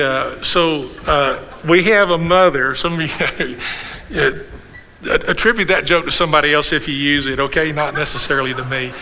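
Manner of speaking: usual speaking voice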